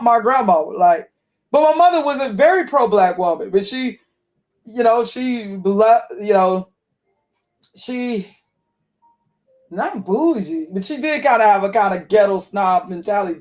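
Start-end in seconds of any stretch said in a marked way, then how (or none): none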